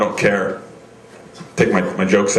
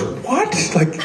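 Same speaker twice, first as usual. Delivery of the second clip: shocked what; 11 semitones higher than usual. Retold or imitated. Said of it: "he goes".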